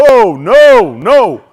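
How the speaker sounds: loud, drawn-out oh no; mock outrage; loud dismay. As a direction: protest in loud disbelief. Disappointed at restaurant.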